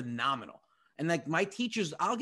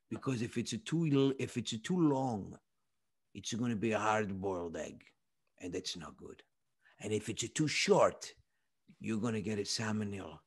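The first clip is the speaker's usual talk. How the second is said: creepy Italian cook, sinking lower as he explains; creepy Italian accent; 4 semitones lower than usual. Retold or imitated. Owner warns about egg timing, creepy Italian accent. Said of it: "so creepy when you do that".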